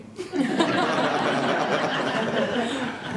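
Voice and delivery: long high laugh, breaking up; real hearty laugh. Real, not acted. Laughing at his own story.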